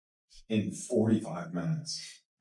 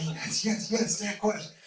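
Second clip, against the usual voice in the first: excited audience; 9 semitones higher than usual. Retold or imitated. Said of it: "everyone's like".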